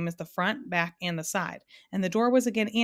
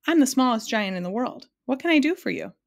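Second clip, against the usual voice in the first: higher, louder, proud self-introduction; 5 semitones higher, 5 dB louder than usual. A narrator's reading. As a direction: announce yourself proudly and eagerly. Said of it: "he replied proudly".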